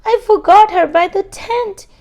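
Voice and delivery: high, loud worried rush; panicked. Acted. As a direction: blurt it out in panic. Sam panicked about crab.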